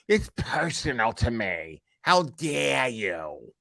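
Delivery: slow, soft, mock-offended whine; mocking imitation of her. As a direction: parody her huffy outrage, dragged out. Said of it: "And she".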